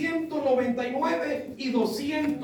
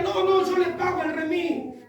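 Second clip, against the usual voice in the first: higher-pitched imitation of an insisting pastor; insistent offer; 7 semitones higher than usual. Pastor insists on paying for the cab, imitated insistence. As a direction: wave away the objection.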